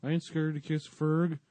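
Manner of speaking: high, loud mock denial; imitating Mark Curry. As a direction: protest in an exaggerated comic voice. Imitates Mark Curry denying fear.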